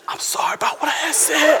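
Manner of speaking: unvoiced, hushed apology; whispered apology. Preacher imitating his whispered apology to his wife during worship. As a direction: whisper it like sorry in church.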